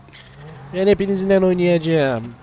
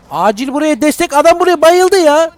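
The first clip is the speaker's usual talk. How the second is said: mock urgent call; 9 semitones higher, 5 dB louder than usual. Calling for help.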